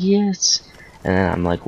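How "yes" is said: very high, squealed mock "yes"; high whiny acted reply; insistent child. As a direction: imitate an exasperated kid insisting.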